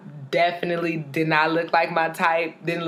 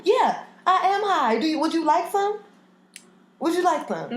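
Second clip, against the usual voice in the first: much higher, sing-song offer; playful high-pitched tease; 9 semitones higher than usual. Acted. Host teasing her cohost about being high, sing-song.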